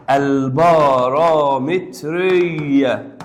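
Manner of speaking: البارامترية stretched very slowly, syllable by syllable; drawn-out term. Shown as a demonstration. Teacher drawing out a new word for the class, very slowly. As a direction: drag out البارامترية for students to catch. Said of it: "وبين قوسين الوسيطية".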